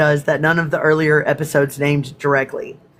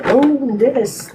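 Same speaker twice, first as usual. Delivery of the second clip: startled; 9 semitones higher than usual. Real, not acted. Reacting to the spill.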